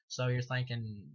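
normal talking voice

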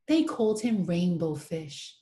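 plain reading voice

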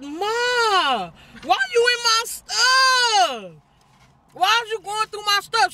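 high, drawn-out mock wail; mock whiny protest. Daughter retelling her protest to mama, high mock whine.

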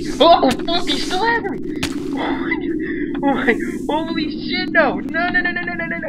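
high, loud frantic shrieks of no; frantic high-pitched yelling; panicked screaming. Real, not acted. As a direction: scream as you're chased. Snake still chasing him.

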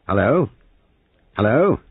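irritable. A narrator's reading. Major trying a dead telephone, irritable. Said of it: "rattling the telephone irritably".

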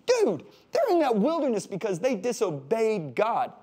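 high mocking know-it-all voice; skeptical listener. A listener in the pews objects to the preacher in a high mocking voice. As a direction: sneer like a smug heckler. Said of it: "sitting there thinking, going".

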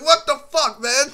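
retold yell of annoyance; exasperated yell. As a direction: blow up at him. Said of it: "I'm like".

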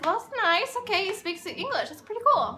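high, mock-pleased thinking aloud; pleased inner reaction. Impressed that he speaks English, pleased high voice. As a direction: lift the voice, acting out being impressed.

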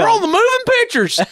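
very high, starstruck country yell. Retold or imitated. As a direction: squeal it like a thrilled bumpkin. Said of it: "the redneck really comes out".

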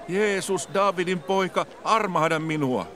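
high, pleading call to a passer-by; a blind beggar crying out for mercy. A narrator's reading. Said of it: "hän alkoi huutaa". (korkea, anova huuto)

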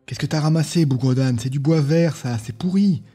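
high, loud contemptuous tirade; scornful scolding. Acted. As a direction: berate him for the rotten wood. Tyrone scolding Sylviane. (engueulade méprisante et forte)

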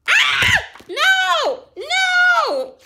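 high, loud panicked screams; frightened screams. Acted. Townsfolk flee the wolf, high panicked screams.

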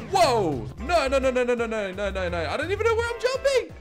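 ordinary talk

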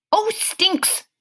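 a hint of Grover grumbling; mild grumble; mumbled. A narrator's reading. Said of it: "he mumbled".

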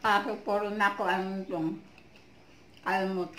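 talking with food in mouth; chewing while talking. Real, not acted. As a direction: mouth partly full of mango. Seen 1: Bit food at -2s, lips pursed and cheeks working while talking.

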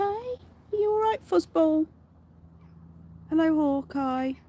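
high, slow pet voice. Real, not acted. Owner fussing over her rabbit in high, slow baby talk. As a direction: fuss over the bunny.

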